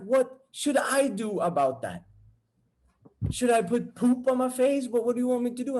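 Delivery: high-pitched emphatic comeback; exasperated girlfriend. Retold or imitated. Girlfriend answering jealous boyfriend, exasperated. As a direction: voice her indignant questions.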